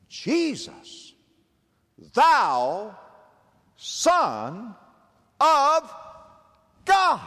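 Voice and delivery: high, loud, drawn-out cry, word by word; demons crying out. Acted. Preacher voicing demons crying out to Jesus, high loud cry. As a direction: wail each word up like a possessed man. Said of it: "they cried out".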